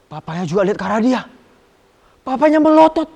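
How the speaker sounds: put-on lively, loud storytelling for kids; storytelling narration. A narrator's reading. Sunday school teacher telling children a story, animated and loud. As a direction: tell the story with big rising drama.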